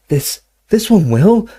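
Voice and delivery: high, slow, halting gasp. A narrator's reading. Harry promising the drink will work, high halting gasp. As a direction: gasp it out urgently. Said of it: "gasped Harry".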